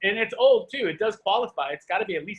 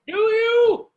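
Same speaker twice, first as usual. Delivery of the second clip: much higher and louder, shouted; sudden shout; 11 dB louder than usual. Acted. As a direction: blast the repeat at her. Challenging her, then repeating louder.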